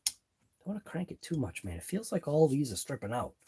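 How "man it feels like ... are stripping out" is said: ordinary delivery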